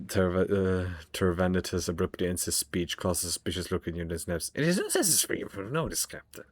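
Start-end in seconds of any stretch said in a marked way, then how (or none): 4.5-6.5 high, eerie surgeon creature voice; creepy homunculus voice. Acted. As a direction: voice a gloating flesh-crafter, high and strange. Homunculus voice continues.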